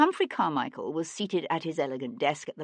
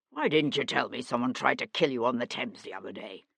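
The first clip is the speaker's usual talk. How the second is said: ordinary delivery, nothing marked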